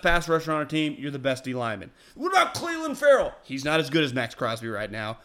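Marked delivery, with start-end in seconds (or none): 2.1-3.3 much higher indignant question; mock outraged fan. Host mimics a fan objecting to his tweet, indignant and high. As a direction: whine it like a fan defending his player. Mocks angry fans' reaction.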